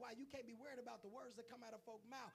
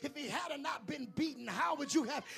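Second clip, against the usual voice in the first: loud, higher pulpit shouting; full-force sermon holler; preaching at full force; 5 semitones higher, 15 dB louder than usual. Acted. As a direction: thunder it at the congregation.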